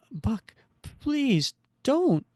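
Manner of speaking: high, slow whispery begging; frightened plea. Acted. Woman begs her partner facing a switchblade, frightened. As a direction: plead in a drawn-out, airy high voice.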